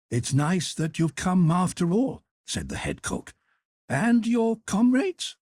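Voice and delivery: high, brisk matronly hotel cook; head cook voice. Acted. Head cook greeting Carl, high and bustling. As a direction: lift the pitch high for the kindly head cook.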